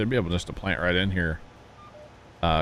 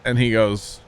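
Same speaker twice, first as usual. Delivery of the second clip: ordinary delivery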